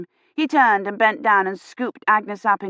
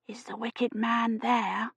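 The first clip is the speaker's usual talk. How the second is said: soft unvoiced child's whisper; 4 semitones higher, 8 dB quieter, slower than usual. A narrator's reading. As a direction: breathe the question, frightened child. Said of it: "Agnes whispered".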